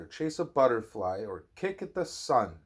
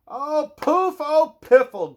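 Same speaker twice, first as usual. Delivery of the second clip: high, loud huffy oh poof oh piffle; a girl's loud frustrated grumble; grumbling princess; 11 dB louder than usual. Acted. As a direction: huff it out like a cross little princess. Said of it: "grumbled Princess".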